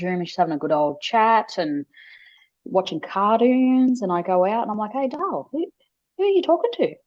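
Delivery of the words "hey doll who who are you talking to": a lightly voiced mum, sweetly asking her little girl; gentle mum voice. Mum gently asking her toddler who she talks to, light sweet voice. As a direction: coax it out of a toddler, light and curious.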